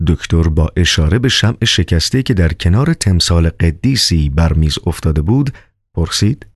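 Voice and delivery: ordinary delivery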